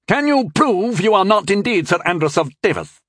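a mock official challenging the knight; stern challenge. A narrator's reading. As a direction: press him like an interrogator. Captain's challenge.

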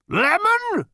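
very high, loud yell; shouted. A narrator's reading. Number two yelling at Ford. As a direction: bark the one word.

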